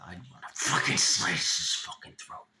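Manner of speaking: forceful, voiceless stage whisper; menacing whisper. Retold or imitated. Reliving threats against a preschool kid, harsh intense whisper. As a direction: whisper it with violent emphasis.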